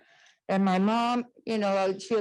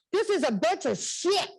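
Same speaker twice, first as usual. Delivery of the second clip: raised, indignant retold outburst; angry outburst; 7 semitones higher than usual. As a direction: blurt it out in half-mock anger. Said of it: "I was angry".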